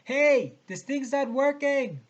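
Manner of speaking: long, high, loud holler to people far below; yelling down. Acted. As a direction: shout it slowly so it carries down. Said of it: "I yelled down at the hoisters".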